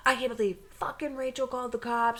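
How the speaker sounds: quick, softened mock griping; fast, put-on grumble; bitching. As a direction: imitate someone complaining in a rush. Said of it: "You were, like, bitching about".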